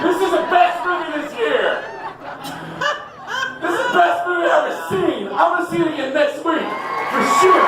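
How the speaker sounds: high, shouted overexcited moviegoer; hammed-up guy raving after the film; mock rave review. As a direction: yell it like a thrilled fan. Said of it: "all the guys come out at the end of the trailer going".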